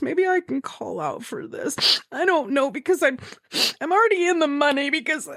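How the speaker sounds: high, giddy self-talk. Retold or imitated. Gamer planning to call out of work, giddy and high. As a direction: talk yourself into it, excited and high. Retold talking herself into skipping work.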